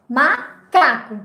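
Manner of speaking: "Macaco" slowly, split as "Ma" then "caco"; slow, divided "Macaco" for children; joining syllables. Shown as a demonstration. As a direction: say "Macaco" in two pieces, a gap after "Ma". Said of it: "se eu disser ma, né, e depois disser caco".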